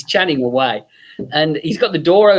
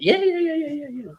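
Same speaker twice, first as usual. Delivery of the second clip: high rapid yeahs fading softer; eager yeahs; 12 semitones higher, 5 dB quieter than usual. Retold or imitated. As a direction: nod it off breezily. Jerry confirming eagerly.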